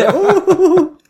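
a hammed-up startled gasp; mock gasp oh.

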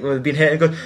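ordinary talk

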